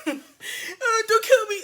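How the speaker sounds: high, laughing mock whimper; mock him begging for his life; lightly voiced whiny coward; sniveling coward. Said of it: "T-Lock is a sniveling piece of shit".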